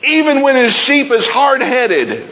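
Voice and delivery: high, ringing preached line; preaching at full force. Acted. Preacher stressing God's love for stubborn sheep, high and ringing. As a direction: proclaim "even when" up high.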